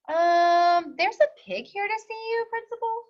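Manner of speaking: high, hesitant secretary voice; timid secretary. Acted. Secretary telling the principal a pig is here, high and unsure.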